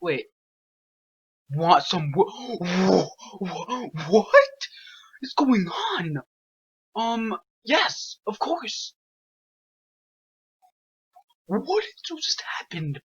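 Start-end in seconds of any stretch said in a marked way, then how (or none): 1.5-13.0 stammering high frightened cries; scared horror game streamer. Real, not acted. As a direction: shaken and stuttering in fright.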